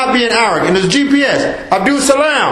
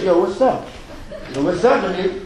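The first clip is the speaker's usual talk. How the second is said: a softer, laid-back imitation of street talk with a laugh; casual slang greeting, mocking the youth; lightly voiced young guy on the street; 3 semitones lower, 7 dB quieter than usual. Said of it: "I gotta demonstrate".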